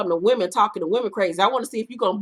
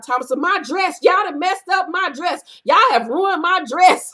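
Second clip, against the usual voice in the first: mocking a wailing bride, pitched higher and louder; upset Trina; 7 semitones higher, 4 dB louder than usual. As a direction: whine about the ruined dress like a hammed-up diva. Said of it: "You hear Trina in the background".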